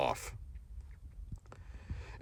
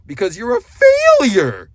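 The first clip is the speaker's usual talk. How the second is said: much higher, louder yell, stretching FAILURE out; drawn-out shout; 13 semitones higher, 10 dB louder, slower than usual. Real, not acted. Vlogger berating the man he is lecturing, drawn-out high shout. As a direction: bellow it slowly as an insult, pitch soaring on FAILURE. Berating Marshall in his video.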